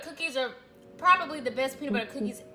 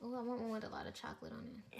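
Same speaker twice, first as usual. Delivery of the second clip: whispering; 3 semitones lower, 15 dB quieter than usual. Real, not acted. Eager whisper over the cookies.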